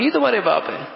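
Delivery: high questioning tune on تمہارے باپ. Shown as a demonstration. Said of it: "سوال کر رہا ہوں".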